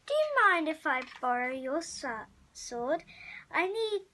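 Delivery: ordinary delivery, nothing marked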